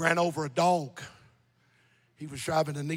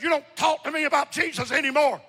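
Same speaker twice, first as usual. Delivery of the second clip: higher-pitched mock warning to a brother; forceful retold threat; 8 semitones higher, 4 dB louder than usual. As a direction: re-enact warning him off, preacher style. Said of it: "I'd threaten him".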